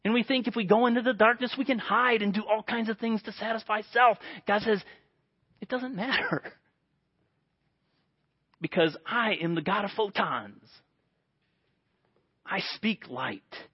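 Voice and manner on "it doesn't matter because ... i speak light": God's calm voice. Retold or imitated. God's voice, quiet and slow.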